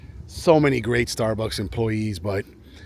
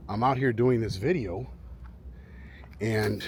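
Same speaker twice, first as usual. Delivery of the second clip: ordinary talk, nothing marked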